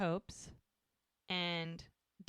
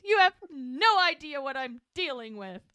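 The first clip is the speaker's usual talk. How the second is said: higher, louder melodramatic wail; louder hammed-up complaint to Doc; melodramatic Hope; 6 semitones higher, 8 dB louder than usual. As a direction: overplay the suffering like a teen. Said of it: "She's so dramatic".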